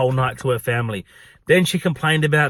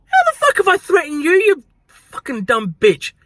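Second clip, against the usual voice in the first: very high-pitched outraged outburst; mock incredulous fury, dragging out the insult; 12 semitones higher, 4 dB louder than usual. Pub worker retorting to a customer's accusation, outraged.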